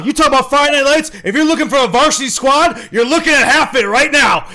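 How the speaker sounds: over-the-top introduction ending in a whoop; hype announcer. Acted. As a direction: hype the crowd like a varsity squad intro. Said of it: "let's take it down a notch".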